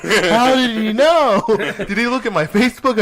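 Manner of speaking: loud, high, drawn-out comic outrage; mock disbelief. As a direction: wail it, baffled, through laughter. Acting out own baffled reaction.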